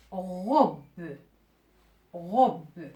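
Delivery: robe drawn out as "ro... be"; syllables joined slowly. Shown as a demonstration. Teacher associating syllables to form words, slowly sounded out. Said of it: "on va associer ces syllabes pour former des mots".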